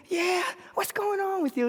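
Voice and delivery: high-pitched friendly question; shrill small man. Acted. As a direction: ask in a high little-man voice. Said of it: "this shrill voice that says".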